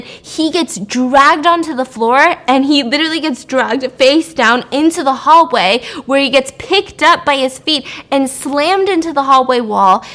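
usual speaking voice

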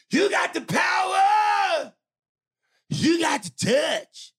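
huge, high, drawn-out holler; belted shout. Acted. Host reading a fan's email aloud, belting it high and slow. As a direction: belt each word out long and loud.

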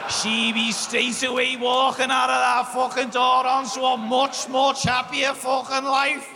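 normal talking voice

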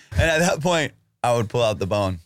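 ordinary talk, nothing marked

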